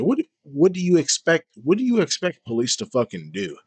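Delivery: high-pitched, exasperated questioning; incredulous. Real, not acted. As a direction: demand it in loud, high disbelief. Incredulous rant at a streamer.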